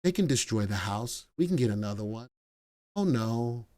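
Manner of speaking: gently permissive, quietly waving it off; indulgent wife. Retold or imitated. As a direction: play the easygoing grandma, hushed. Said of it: "And my wife is like".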